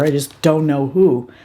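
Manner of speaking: ordinary talk, nothing marked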